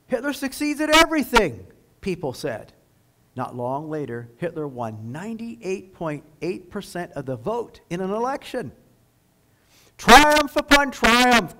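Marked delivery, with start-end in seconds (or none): 0.1-1.7 excited exclaim. Retold or imitated. Crowd's boast, quoted by preacher. 10.0-11.6 slow, high and much louder triumphant cry; gloating. Retold or imitated. Preacher voicing Goebbels to his congregation, loud gloating. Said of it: "gloated Goebbels".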